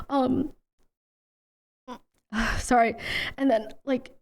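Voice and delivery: usual speaking voice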